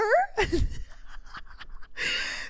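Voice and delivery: breathless, wheezy laugh; helpless giggling fit; real laughter. Real, not acted. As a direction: crack up mid-story. Laughing while retelling his laughter.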